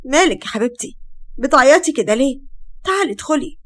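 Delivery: ordinary delivery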